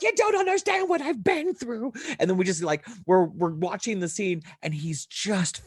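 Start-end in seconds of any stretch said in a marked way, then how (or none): 0.0-1.9 high, frantic fan retort; worked-up cry, voice way up high; overwrought outburst. Retold or imitated. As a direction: re-enact an overexcited dad pleading to be understood. Overexcited dad answering his kids.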